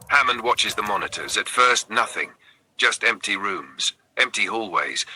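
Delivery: ordinary delivery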